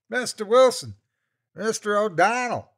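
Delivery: high, loud calls; searchers calling. A narrator's reading. Search party calling for the missing men, loud. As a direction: call the names across the valley. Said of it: "The voices called again".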